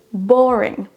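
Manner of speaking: "Boring" with long BO, falling; first-syllable stress. Shown as a demonstration. Teacher closes the drill, "Boring" stressed on BO. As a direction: draw out BO in "Boring". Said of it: "La première syllabe est prononcée plus fort".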